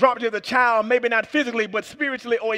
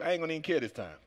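normal talking voice

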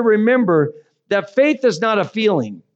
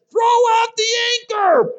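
shouted instruction. Retold or imitated. Other boater shouts advice.

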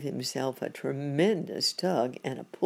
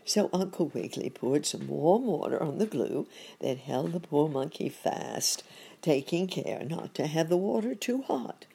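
plain reading voice

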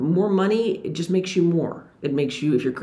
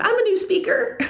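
laughing. Retold or imitated. Proud answer to famous speaker.

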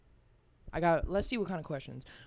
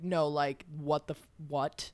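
ordinary talk, nothing marked

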